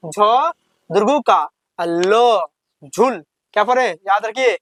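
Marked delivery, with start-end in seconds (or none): 0.1-3.2 drawn-out syllable-by-syllable reading of झ दुर्गु का ल झुल; slow spelled-out reading. Shown as a demonstration. Teacher spelling झुल slowly for young pupils. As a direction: stretch each syllable of झुल slowly for the class. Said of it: "इसको पढ़ना है झ दुर्गु का".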